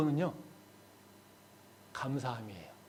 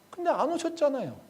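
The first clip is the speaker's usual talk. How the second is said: Mary's hurt reproach; 11 semitones higher than usual. Acted. Mary telling Jesus he never came, softened hurt reproach. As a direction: let the outburst fall away into hurt. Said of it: "지금 마리아가 그 얘기를 하고 있는".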